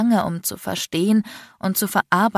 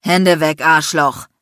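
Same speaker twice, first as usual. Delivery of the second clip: loud, icy command; icy roar; 8 dB louder, slower than usual. A narrator's reading. Man orders a harasser to back off, icy roar. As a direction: roar it coldly. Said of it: "brüllte eine eisige Stimme".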